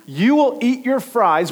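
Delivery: high, loud slow parental threat; stern father. Retold or imitated. Father threatening toddler at dinner, loud slow warning. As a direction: lay down the law, slowly and loud.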